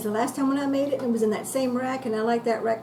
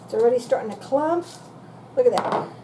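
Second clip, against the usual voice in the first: ordinary delivery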